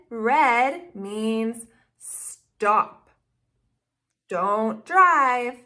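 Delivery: slow teaching. Shown as a demonstration. Teaching traffic light colors.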